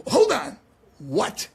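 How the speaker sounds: high, loud "hold on", then a curt "what"; perturbed. Retold or imitated. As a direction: imitate a busy boss annoyed at interruption. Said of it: "now he's kind of perturbed".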